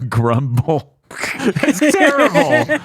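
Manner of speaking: high-pitched, laughing exclamation; cracking up. Real, not acted. Reader reacting to a silly Pokemon name, cracking up. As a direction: squeal it through real laughter, drawn out.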